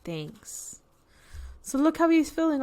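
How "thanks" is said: much softer, lower murmured thanks; quiet thanks. Acted. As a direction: barely say it, shy and low. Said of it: "And the bear says".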